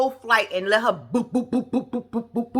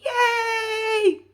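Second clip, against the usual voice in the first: high happy yay; bright high cheer; 13 semitones higher than usual. Real, not acted. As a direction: celebrate the wedding. Cheering the marriage.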